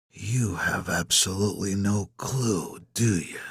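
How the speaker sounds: soft, slow, knowing taunt; hushed, unhurried confidence; sudden confidence. A narrator's reading. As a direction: say it quietly, sure of yourself. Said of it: "with sudden confidence".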